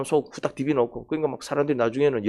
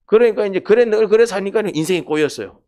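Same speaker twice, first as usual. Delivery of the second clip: higher, louder preacher's scolding; louder, raised preaching; emphatic rebuke; 6 semitones higher, 4 dB louder than usual. Real, not acted. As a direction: drive home the scolding.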